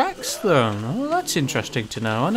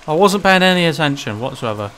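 ordinary talk

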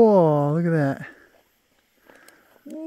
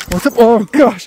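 ordinary delivery

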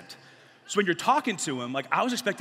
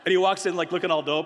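normal talking voice

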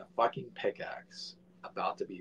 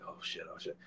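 breathless whispered panic; scared whisper; 14 dB quieter, faster than usual. Retold or imitated. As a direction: whisper it in fear on the tombstone. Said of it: "I'm like".

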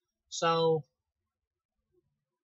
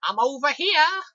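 high, loud, slow teasing call; sing-song taunt from behind the tree; mock leprechaun taunt; 12 semitones higher, 11 dB louder, slower than usual. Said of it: "and he's like".

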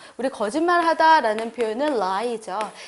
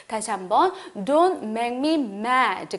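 ordinary talk

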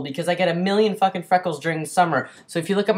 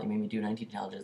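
ordinary talk

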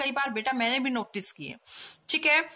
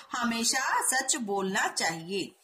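हमेशा सच बोलना चाहिए read slowly and evenly; slow careful reading; 2 semitones lower, slower than usual. Shown as a demonstration. Teacher dictating the corrected sentence to students. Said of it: "सही वाक्य क्या होगा".

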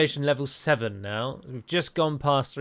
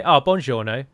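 buongiorno with plain English sounds, louder; English-accented Italian; 6 dB louder than usual. Shown as a demonstration. Player mimics an English person greeting, English accent on buongiorno. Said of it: "They'd say it in English".

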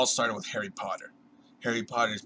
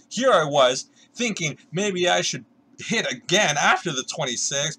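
much louder, much higher, outraged shouting; outraged yell; 8 semitones higher, 8 dB louder than usual. Retold or imitated. Ranting at Buddy after busting at blackjack, furious yell. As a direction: blow up at him in disbelief. Yelling at friend after bust.

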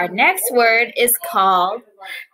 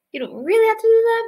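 quick high aside; 11 semitones higher, 3 dB louder, much faster than usual. Acted. Aside to kids.

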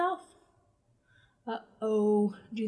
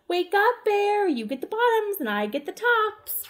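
loud, high wake-up call; 8 semitones higher, 4 dB louder than usual. Acted. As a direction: shout to rouse him. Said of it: "Hare called out".

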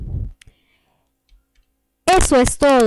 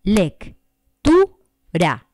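Lec, tu, ra tapped out in separate beats; clapped syllables; much slower than usual. Shown as a demonstration. Teacher repeating lectura split into syllables. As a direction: count Lec, tu, ra like claps. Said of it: "cada uno se pronuncia en un golpe de voz distinto".

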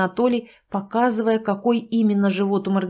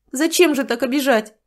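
higher, louder wounded question; hurt reproach; 5 semitones higher, 4 dB louder than usual. Acted. Tatyana reproaches Sanych, hurt and pouting. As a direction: complain you were insulted.

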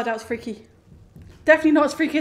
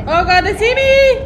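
panicked high yelp; spotted and freaking out; 9 semitones higher, 9 dB louder than usual. Real, not acted. Panicked gamer spotted by monsters.

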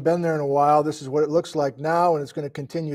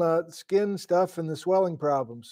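ordinary talk

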